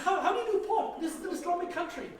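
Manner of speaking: high, loud, laughing amazement; laughing disbelief. Retold or imitated. Traveller laughing at pork offer, high and loud. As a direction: crack up in disbelief.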